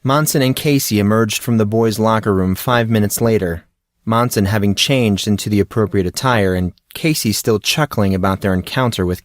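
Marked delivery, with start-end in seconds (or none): none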